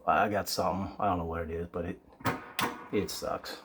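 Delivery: hoarse, worn-out voice from a cold; a raspy sick voice. Real, not acted. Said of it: "apologize for my, uh, raspy voice".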